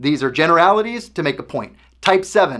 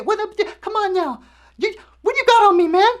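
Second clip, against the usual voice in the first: much higher, mock-tough squeaky challenge; a hammed-up boxer voice picking a fight, going ever higher; Mike Tyson impression. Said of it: "sorry about my horrible impersonation".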